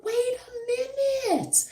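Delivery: high, slow dawning surprise; a high, slowed wait a minute; realizing. Retold or imitated. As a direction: stop short as it finally clicks. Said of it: "So I was like".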